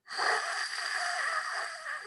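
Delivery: a long hiss of air through the throat; breathy ch hiss. Shown as a demonstration. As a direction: blow air through a narrow gap. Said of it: "you would say".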